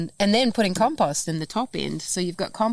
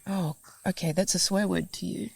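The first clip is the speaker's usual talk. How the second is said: quiet dry aside; 5 dB quieter than usual. Retold or imitated. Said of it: "And I was like".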